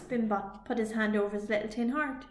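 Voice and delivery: plain reading voice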